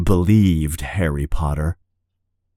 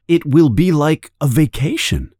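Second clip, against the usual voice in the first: ordinary delivery, nothing marked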